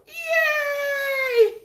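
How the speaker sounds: high, loud squeal of joy; excited cheer. Real, not acted. Cheering about breakfast burritos.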